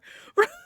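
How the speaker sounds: loud, high laughing burst; burst of laughter. Real, not acted. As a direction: crack up loudly. Laughing at the story.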